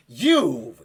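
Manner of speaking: loud furious cry; angry shout. Acted. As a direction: spot the foe and lunge. Knight confronts an enemy.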